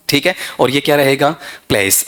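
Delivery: normal talking voice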